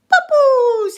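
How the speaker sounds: put-on very high, loud toddler squeal; high-pitched imitation of a small child; imitating toddler grandson. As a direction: mimic a wee Scottish tot at full volume. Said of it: "listen to him".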